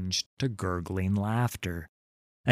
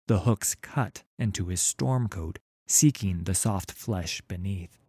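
usual speaking voice

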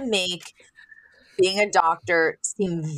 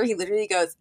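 usual speaking voice

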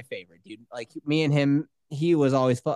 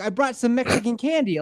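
put-on higher excited kid with Italian lilt; mock Italian-sounding accent; 6 semitones higher than usual. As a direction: imitate an eager boy, sounding Italian. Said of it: "Sounds Italian".

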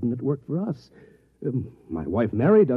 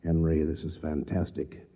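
hushed awe; 7 semitones lower, 5 dB quieter than usual. Acted.

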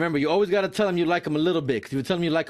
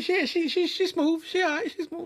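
much higher, put-on smooth voice; mock smooth voice; 14 semitones higher than usual. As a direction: ham up a lazy compliment. Mocking coworker's reply.

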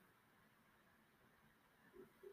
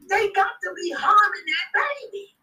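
distressed, higher pleading outcry; horrified cry; 6 semitones higher than usual. Retold or imitated. Protesting to the crew in horrified distress. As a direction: cry out in horror for the baby.

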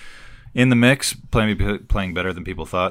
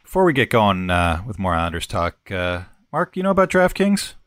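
upbeat sponsor read, a bit louder; 2 semitones higher, 3 dB louder than usual. Acted. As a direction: sell it like a radio spot. Host reads sponsor ad.

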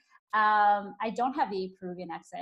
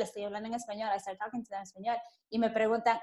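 ordinary talk, nothing marked

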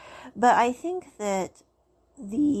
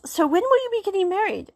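friend's eager question; 11 semitones higher, 5 dB louder, faster than usual. Retold or imitated. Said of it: "one of his friends says".

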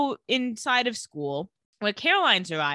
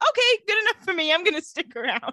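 high, louder, quick giving-in; mock agreement; 8 semitones higher, 4 dB louder, faster than usual. Captive Elena answering Rose's warning, mock agreement. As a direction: agree hastily in an acted high voice.

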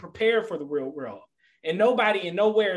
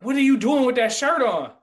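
higher and louder, challenging the shirt; Irish coworker confronting him at work: loud indignant challenge; 8 semitones higher, 6 dB louder than usual. Retold or imitated. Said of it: "He's like".

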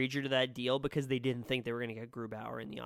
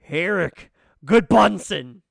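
mock hype; 2 semitones higher, 13 dB louder, slower than usual. Hyping big signing ironically.

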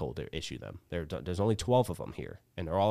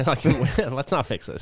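loud, high laughing quip; laughing mock dismissal; 7 semitones higher, 9 dB louder than usual. Real, not acted. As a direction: joke through laughter. Joking about broken artillery.

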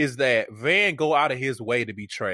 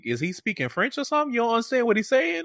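incredulous retelling; 9 semitones higher, faster than usual. Retold or imitated. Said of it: "I was like".

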